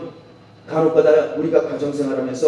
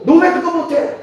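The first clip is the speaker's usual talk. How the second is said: mock angry yell, pitched high; imitating a scolding big brother; 13 semitones higher, 6 dB louder than usual. Older brother yelling at younger siblings, mock scolding. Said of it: "막 윽박 지르고".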